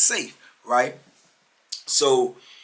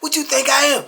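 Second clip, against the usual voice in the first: high, louder mock scream; raised-voice retelling of furious threats; retold scream; 10 semitones higher, 4 dB louder than usual. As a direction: imitate a man screaming threats in a rage. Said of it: "He started screaming".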